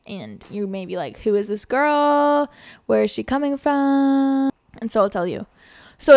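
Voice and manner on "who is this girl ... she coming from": loud, high, drawn-out questions with held final words; mock wondering. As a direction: imitate baffled listeners, stretching the ends out slowly. Voices puzzled listeners in mock wonder.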